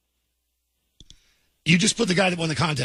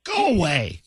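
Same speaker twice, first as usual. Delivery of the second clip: ordinary talk, nothing marked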